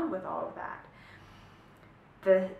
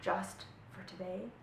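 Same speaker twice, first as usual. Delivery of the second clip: softer, kind reply to yourself; gentle self-talk; 9 dB quieter than usual. Retold or imitated. Teacher modelling loving self-talk, soft and gentle. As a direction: soothe the inner voice like a friend. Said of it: "And say".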